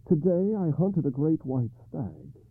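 high, troubled king; King Arthur voice. Acted.

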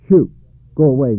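shooing shout. A narrator's reading. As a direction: chase the moose off the porch. Breton shooing moose.

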